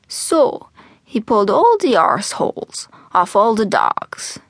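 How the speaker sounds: put-on animated old man's brogue, pitch swooping; old Irish storyteller. Old man to eager young Jack, storytelling brogue. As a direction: spin the yarn slowly, savouring it. Albert tells his joke.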